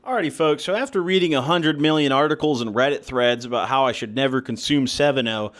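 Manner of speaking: ordinary talk, nothing marked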